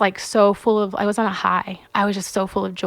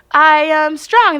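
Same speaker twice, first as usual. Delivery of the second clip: slow call out; 6 semitones higher, 7 dB louder, much slower than usual. Retold or imitated. Calls out declaration to crowd.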